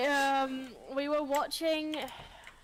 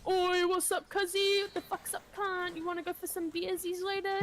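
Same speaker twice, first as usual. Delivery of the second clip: higher, matey Kiwi voice; hammed-up New Zealand accent; 5 semitones higher than usual. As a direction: greet a mate in broad Kiwi. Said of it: "New Zealanders are just like".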